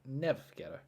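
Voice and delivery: usual speaking voice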